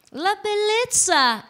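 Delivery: very high, slow gushing presenter voice; Diletta Leotta. Acted. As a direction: coo it like a glamorous sports presenter. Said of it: "fare Diletta Leotta che non ride".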